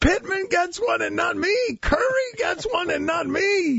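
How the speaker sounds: high, shouted mock Lithuanian accent. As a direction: ham up a Lithuanian accent for a giant robbed of his trophy. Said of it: "It's a terrible Lithuanian".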